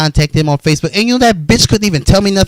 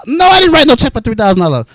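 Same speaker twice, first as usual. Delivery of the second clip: indignant shout; 8 semitones higher, faster than usual. Retold or imitated. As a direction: yell it down the phone in disbelief. Denying the check on phone.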